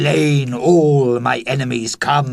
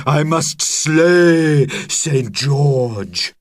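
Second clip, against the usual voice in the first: louder, slow, grim dragon vow; determined cry; 5 semitones higher, 5 dB louder, slower than usual. A narrator's reading. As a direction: declare the vow heavily. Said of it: "cried Dragon".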